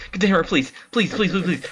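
insistent, rising pleas; urgent pleading. Real, not acted. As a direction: plead frantically for it. Begging for an item.

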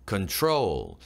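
"Control" with the stress on "trol"; light "Con", long falling "trol" in "Control"; second-syllable stress. Shown as a demonstration. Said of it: "둘째 음절에 있고".

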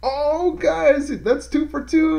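high, bright-eyed hello to viewers; big excited opener; excited greeting. Real, not acted. Greeting viewers at the start of a Let's Play, excited and high.

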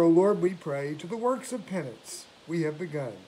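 ordinary talk

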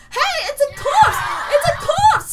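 high, loud excited shout; bursting with glee at the find; excited anime fan. Real, not acted. Excited about the corpse.

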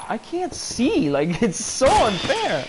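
high, slow, stretched complaining whine; drawn-out whine. Real, not acted. Frustrated whining at off-screen enemy.

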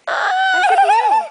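very high, loud sing-song question; squeaky high voice. Pretend. Mother asking toddler in a high squeaky play voice. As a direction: pitch way up like a cartoon.

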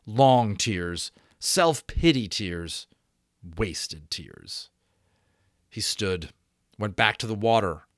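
ordinary delivery

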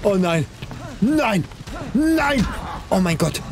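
panicked high cries; scared. Real, not acted. Chased by monster in game.

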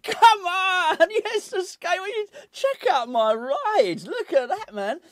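high, loud mock thrill over a skateboard; mock excitement. Fan pretending a skateboard is a Lamborghini, hammed-up excitement. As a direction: sell the skateboard like a sports car, hammed up.

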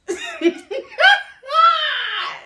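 very high staccato put-on cackle; squealing laugh with a long falling tail; mock cackle. Mocking the fish pond man, high fake cackle.

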